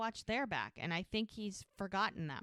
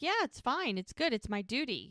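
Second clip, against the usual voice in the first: high, insistent mock reassurance; higher, louder retold brush-off; 5 semitones higher, 4 dB louder than usual. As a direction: imitate someone too quick to say he's fine. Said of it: "he was like".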